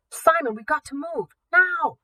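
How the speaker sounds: high, loud and rising to a yell on Now; panicked urging. Acted. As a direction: push him, panic building. Alarm counting down, urging Simon.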